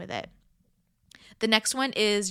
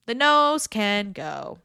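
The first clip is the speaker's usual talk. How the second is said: slow and louder, each word landed; slow emphatic repeat; 5 dB louder, much slower than usual. Shown as a demonstration. Coach to listener, slow emphatic repeat. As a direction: drive the rhyme home slowly.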